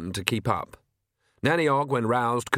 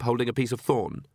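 plain reading voice

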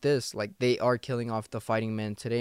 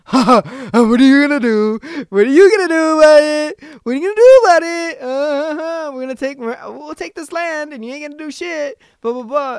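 much higher, loud, sing-song schoolyard taunt; mocking taunt; 13 dB louder than usual. NATO countries taunting Putin in a high, loud, mocking kid voice. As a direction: tease like a bratty kid daring him. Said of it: "they're trying to poke fun at him".

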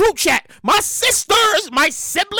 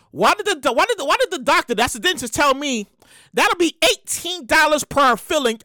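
usual speaking voice

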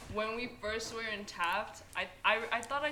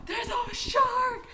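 retold yell; 14 semitones higher, 5 dB louder, much slower than usual. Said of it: "someone just yells".

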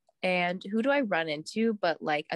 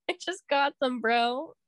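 high, slow, whining put-on voice with a laugh; whiny wail; 9 semitones higher, much slower than usual. Lamenting her new sneakers to the sick friend, high whine. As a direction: wail over the new shoes like a sulking kid. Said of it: "was like".

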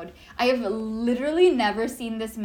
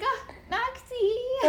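much higher, very slow doting coo; fond coo; 12 semitones higher, much slower than usual. Real, not acted. Fan doting on her favourite driver, slow fond coo. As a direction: coo over him like a proud fan.

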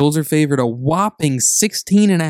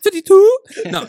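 squeaky cracked voice, by accident; voice cracking; 8 dB louder than usual. Real, not acted. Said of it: "His voice cracked".

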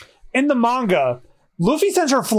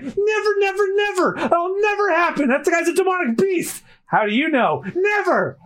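high, frantic nevers; shrill scared refusal repeated; panicked Kobe; 9 semitones higher than usual. Acted. Kobe panicking when Luffy mentions recruiting Zoro.

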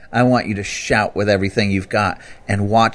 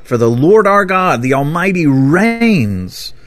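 mock crying out; 7 semitones higher, 3 dB louder than usual. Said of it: "crying out".

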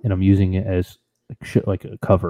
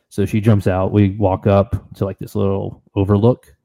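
ordinary talk, nothing marked

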